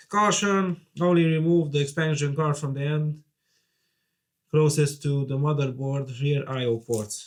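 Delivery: reading a warning aloud. Real, not acted. Reads sticker warning out loud.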